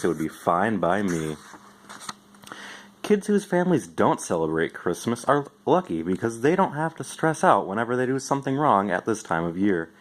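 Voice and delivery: ordinary delivery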